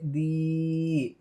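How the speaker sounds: दी held on a long steady ee; drawn-out दी. Shown as a demonstration. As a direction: stretch दी right out. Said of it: "खींच कर पढ़ें, जैसे". (दी को खींच कर पढ़ना)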